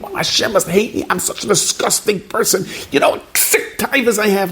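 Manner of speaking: high, whiny self-loathing thought with a rueful laugh; self-pitying inner voice. Retold or imitated. A person talking to himself about his own flaws, high whiny self-pity. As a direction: whine it like someone sure God hates them. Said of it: "we think".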